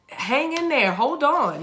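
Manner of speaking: sing-song encouragement. Acted. Encouraging viewers here for comments.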